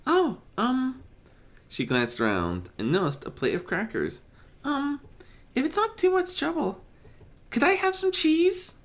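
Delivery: high, hesitant halting ask; a shy pony politely begging for cheese; timid pony request. Acted.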